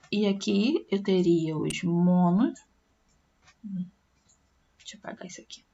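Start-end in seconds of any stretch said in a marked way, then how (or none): 4.8-5.6 soft whispered aside to herself; barely voiced, under the breath while erasing; muttered aside. Real, not acted. Teacher erasing the board, whispering to herself.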